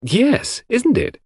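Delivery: a hint of a cheerful young nurse, amused; lightly voiced young woman. A narrator's reading.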